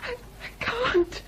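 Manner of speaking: slow, trembling with a sob; can barely get the words out for fear; frightened, near tears. Acted. Lockwood refusing the ghost, slow and tearful with fear.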